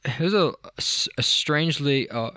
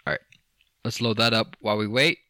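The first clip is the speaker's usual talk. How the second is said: ordinary delivery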